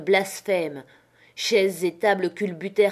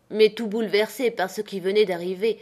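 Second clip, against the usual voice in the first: ordinary delivery, nothing marked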